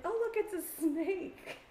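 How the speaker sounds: put-on high, slow kid voice pointing out a snake; little boy voice. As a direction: voice a delighted small child. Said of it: "And he was like".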